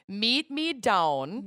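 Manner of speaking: "Meet me down" slow, flat Minnesota vowels; Minnesota accent. Shown as a demonstration. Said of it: "we'll break it down".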